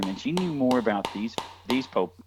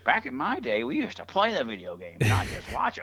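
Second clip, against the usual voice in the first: higher mock old-timer grumbling; back in my day rant; 6 semitones higher than usual. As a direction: ham up a cranky old-timer.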